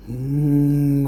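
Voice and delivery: eerie closed-mouth "hmm" moan; a haunted whimpering hum. A narrator's reading. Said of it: "అని శబ్దం చేస్తూ నెమ్మదిగా ఏడడం".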